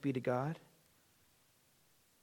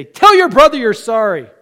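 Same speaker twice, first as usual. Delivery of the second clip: stern mom; 13 dB louder than usual. Retold or imitated. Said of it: "and say".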